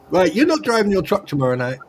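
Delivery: ordinary talk, nothing marked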